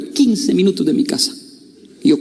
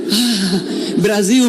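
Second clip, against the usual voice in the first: nervous laugh; 3 semitones higher, much slower than usual. Retold or imitated. Timid reply to German fans.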